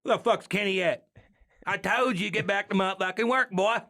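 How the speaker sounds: high, loud joking boss yell; mock angry boss. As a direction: yell like a boss chewing out a worker. Mock yelling at Kenny.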